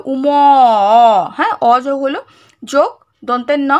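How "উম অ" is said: "উম" stretched loudly into a long "অ"; inherent vowel demonstration. Shown as a demonstration. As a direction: sustain the final "অ" of "উম", louder. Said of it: "তাই অ যোগ হবে".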